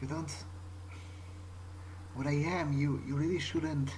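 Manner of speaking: ordinary talk, nothing marked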